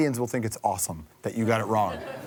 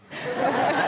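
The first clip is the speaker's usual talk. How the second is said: laugh. Real, not acted. Host laughs at joke.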